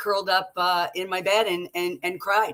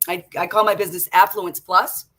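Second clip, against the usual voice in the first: ordinary talk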